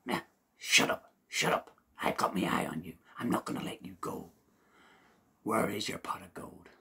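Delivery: rough shout. Acted. Seamus shakes the leprechaun, gruff loud demand. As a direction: shake him down with your voice.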